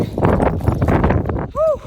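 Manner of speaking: loud winded exhale; breathy whew. Real, not acted. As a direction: blow out a tired whew. Exhale after the climb.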